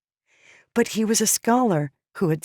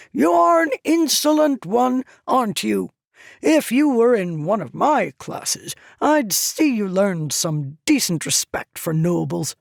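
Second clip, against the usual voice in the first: much higher, louder lordly rebuke; indignant scolding; 6 semitones higher, 3 dB louder than usual. A narrator's reading. Tyrell rebukes the insolent slave girl indignantly. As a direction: scold her like an offended noble.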